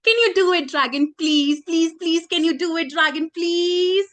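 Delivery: high, insistent string of pleas; eager begging. Acted. Princess begging the dragon to fly, pleading.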